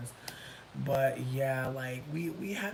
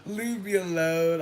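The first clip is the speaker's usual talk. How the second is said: weary brush-off; 2 semitones higher, 5 dB louder, slower than usual. Retold or imitated. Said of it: "I was like".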